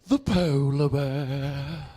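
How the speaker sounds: slow, solemn documentary narration; David Attenborough impression. Comic mimicking Attenborough for the crowd, hushed and grave. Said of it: "Why does he say it like the ghost of Christmas future".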